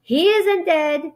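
high, drawn-out protest; mild narrated holler. A narrator's reading. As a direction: call out a firm denial. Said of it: "hollered Zuckerman".

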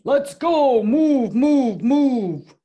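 loud, high shouted command; shouting. A narrator's reading. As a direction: shout an order to the herd. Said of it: "and she shouted".

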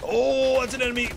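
high, loud "oh" of alarm; startled cry. Real, not acted. Gamer suddenly spotting an enemy, startled. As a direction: burst out high as you spot danger.

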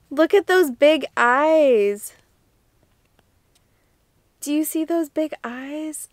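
doting coo. Real, not acted. Cooing over cute sticker eyes.

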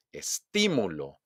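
slow "Estímulo", punched on TÍ; exaggerated second-syllable stress. Shown as a demonstration. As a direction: hit TÍ in a stretched "Estímulo". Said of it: "exagerar la pronunciación". ("Estímulo" lento, con golpe en TÍ)

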